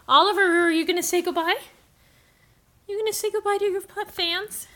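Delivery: high cooing voice to the cat. Real, not acted. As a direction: coo it high and quick.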